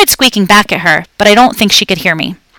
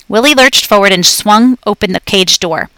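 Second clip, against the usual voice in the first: ordinary delivery, nothing marked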